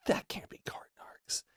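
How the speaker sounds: hushed whisper, as if to yourself; a retold whisper of disbelief under the breath; disbelieving whisper. Disbelief on seeing him, retold.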